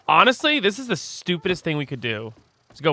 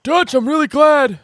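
much higher and louder put-on John voice; John Marston impression; 11 semitones higher, 6 dB louder than usual. To Dutch, a lightly voiced John Marston thanking him. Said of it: "John Marston's hard for me to do".

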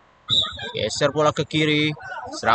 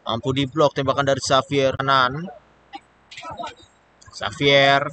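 ordinary talk

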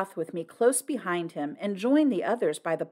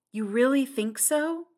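higher-pitched, questioning; a touch higher, asking for reassurance; lightly voiced Flora; 7 semitones higher than usual. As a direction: lightly play a doubtful questioner.

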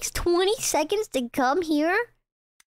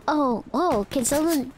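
ordinary delivery

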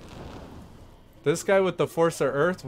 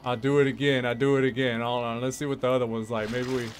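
ordinary talk, nothing marked

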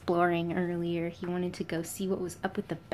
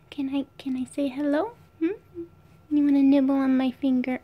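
higher, louder coo to the pet; 6 semitones higher, 5 dB louder than usual. Real, not acted. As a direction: coax the pet up high and eager. Cooing at the pet.

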